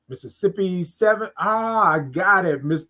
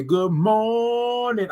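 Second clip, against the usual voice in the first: "Good morning" stretched slowly, every O and R held; imitating Mika's drawn-out greeting; 3 semitones higher, much slower than usual. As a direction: savour each sound of "Good morning" like Mika does. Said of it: "All those O's and R's and I N Gs".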